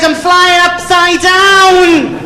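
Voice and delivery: very high, slow comic wail; panicked wail. Acted. Novice flyer telling the controller his position, panicked. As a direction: stretch it out in high mock panic.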